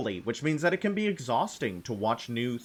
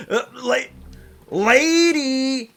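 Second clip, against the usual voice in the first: excited cry; 12 semitones higher, 8 dB louder, slower than usual. Real, not acted. Spots a boss in game.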